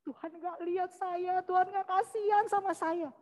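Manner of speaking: high, softened self-pitying complaint; aggrieved grumbling. Retold or imitated. Castaway grumbling at God all day, aggrieved. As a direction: keep grumbling in a small high voice. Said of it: "sepanjang hari dia marah sama Tuhan".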